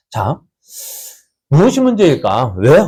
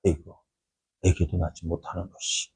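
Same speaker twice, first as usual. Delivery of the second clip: much lower, much softer contemptuous muttered jab; a rival wife belittling Hannah under her breath; sneering taunt; 8 semitones lower, 13 dB quieter than usual. Acted. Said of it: "한나에게는 뭐라 했을까요".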